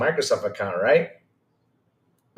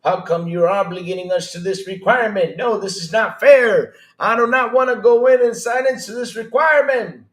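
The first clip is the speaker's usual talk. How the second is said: higher, whiny mock protest rising to a louder peak; mocking fanboys; 8 semitones higher, 4 dB louder than usual. Streamer mocking PlayStation fans protesting the sign-in, whiny and rising. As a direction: parody a complaining fanboy, building into outrage. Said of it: "They're out there fighting this right now saying".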